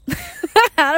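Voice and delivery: a burst of real, high laughter; laughing. Real, not acted. The worker cracking up retelling the uncle's street name, real laugh. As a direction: laugh out loud at the memory.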